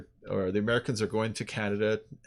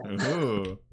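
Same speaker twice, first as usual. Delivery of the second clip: normal talking voice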